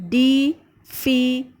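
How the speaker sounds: "difficult" in three slow, separate beats; syllable by syllable. Shown as a demonstration. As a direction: break "difficult" into dif - fi - cult. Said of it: "it is made of three syllables".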